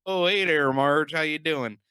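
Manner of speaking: high, loud hammed-up Fargo accent. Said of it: "the snow and the accents".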